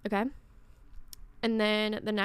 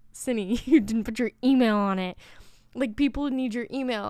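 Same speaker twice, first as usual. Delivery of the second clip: louder, laughing through the words; laughing self-scold; 6 dB louder than usual. Retold or imitated. Telling herself off about the card, laughing. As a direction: scold yourself while laughing.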